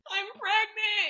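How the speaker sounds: very high, drawn-out wail; retold wailed announcement. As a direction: blurt it out high and stretched. Retold call breaking news to partner.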